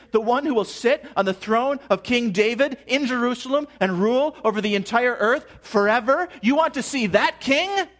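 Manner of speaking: high, loud preacher's call, each want pressed; full-force pulpit climax; preaching at full force. Acted. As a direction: drive every question harder toward the king. Preaching climax, repeated questions.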